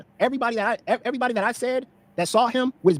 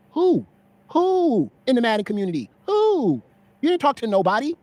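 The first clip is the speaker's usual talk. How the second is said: mocking challenge; 6 semitones higher, 3 dB louder, slower than usual. Real, not acted. Heated stream argument, demanding names.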